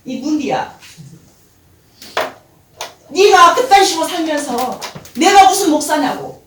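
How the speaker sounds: loud, high angry rebuke; scolding retort. Retold or imitated. Wife snaps back at husband, loud and angry. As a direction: tell him off fiercely. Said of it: "이렇게 말했어요".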